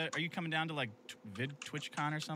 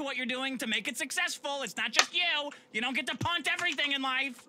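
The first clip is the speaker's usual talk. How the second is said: ranting; 11 semitones higher, 4 dB louder than usual. Real, not acted. Ranting about voicemail callers.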